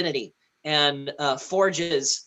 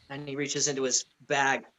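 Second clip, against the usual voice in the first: ordinary talk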